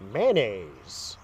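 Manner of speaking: low, lightly mimicked woman; imitating a woman's talk. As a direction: mimic her low, matter-of-fact answer. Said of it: "this is exactly how she talked".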